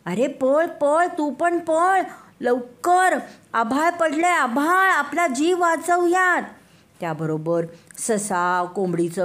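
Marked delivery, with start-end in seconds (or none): 0.1-6.4 scared deer. Acted. Deer warning the monkey to run, high urgent shout. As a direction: hurry him along in panic. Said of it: "हरिण म्हणालं".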